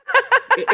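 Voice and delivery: high-pitched laugh; high giggling burst of laughter. Real, not acted. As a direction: crack up at your own story. Cracks up at own story.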